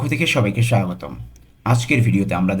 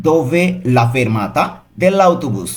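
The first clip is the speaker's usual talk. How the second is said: slow, careful Italian for learners; very slow model reading; 3 semitones higher, 3 dB louder, much slower than usual. Shown as a demonstration. Teacher models the phrase slowly for students. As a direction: spell it out slowly for the class. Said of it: "পুরোটা একসাথে কী বলতে পারি?".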